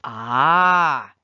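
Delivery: loud, sharp leprechaun exclamation; sharp knowing ah. A narrator's reading. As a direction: catch him out with a sharp ah. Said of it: "declared the leprechaun sharply".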